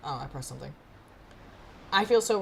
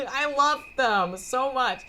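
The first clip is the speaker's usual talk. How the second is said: high, loud laughing adoration; drawn-out squeal of love; real gushing; 6 semitones higher, 6 dB louder, much slower than usual. Real, not acted. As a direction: burst out giggling with affection. Reader gushes, laughing.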